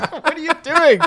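high, laughing shock; laughing at his friend in a Pacers shirt; laughing disbelief. Retold or imitated. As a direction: crack up in disbelief.

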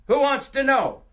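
high, loud, suspicious retort; guarded comeback, pitch jumping up; defensive challenge. Acted. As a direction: throw the question back defensively. Guarded answer to the hostess.

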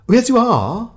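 high, loud cheerful chirp; eager and upbeat, all smiles. A narrator's reading. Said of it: "said Tom cheerfully".

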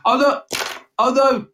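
high, loud hammy squeal; mock alarm. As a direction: shriek it in fake panic.

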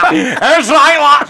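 Turkey Teeth voice. Shown as a demonstration. Showing the host how he gets into Turkey, loud and laughing. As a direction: squeal it out through a cackle, in character. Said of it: "how are you getting into 'em?".

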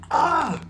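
a sudden high, loud scream of fright; imitated scream. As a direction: shriek as if a statue just spoke. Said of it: "I was just like".

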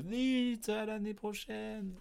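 high, slow eager fan; mock viewer comment. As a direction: gush like an impatient fan. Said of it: "et à dire".

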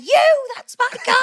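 high-pitched mock outburst; mock indignant call. Retelling Annie Cranky claiming the driver, mock indignant call. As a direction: pitch it up high as if calling someone out.

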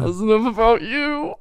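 high, teary laughter; laughing. Real, not acted. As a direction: laugh till you cry. Giggling fit.

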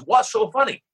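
put-on high Japanese-accented sushi chef; broken English accent. Sushi chef asks the laughing guest, high Japanese accent. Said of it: "speaks broken English".

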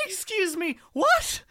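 high, loud disbelieving outburst; shocked shout. Real, not acted. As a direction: yelp it in total disbelief. Shocked at the article title.

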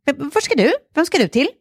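high, over-friendly, crisply articulated questioning; friendly but firm. Retold or imitated. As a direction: challenge him sweetly and firmly in a high voice. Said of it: "blir urvänlig, men så här bestämd".

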